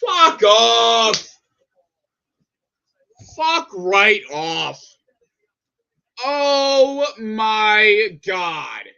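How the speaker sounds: loud, dragged-out furious swearing; angry outburst. Real, not acted. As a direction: stretch each word out in rage.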